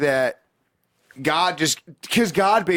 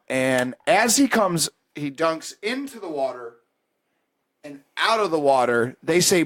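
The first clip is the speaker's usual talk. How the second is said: ordinary talk, nothing marked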